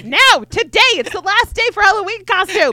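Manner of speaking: excited urging. Real, not acted. Excited urging to photograph mask.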